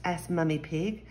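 plain reading voice

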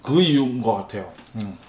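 speaking between bites; talking while chewing. Real, not acted. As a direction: mouth partly full of noodles. Seen 0: Just slurped noodles at -3; jaw moving, food likely in mouth.

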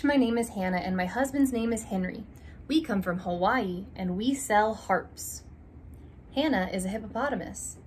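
ordinary delivery

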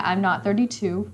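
ordinary talk, nothing marked

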